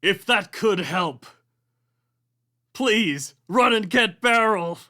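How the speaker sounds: high, loud ghostly plea; pleading ghost shout. Acted. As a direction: beg loudly from inside the stall.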